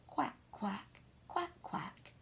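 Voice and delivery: mother duck thanking the golfer; soft duck quacks. Acted.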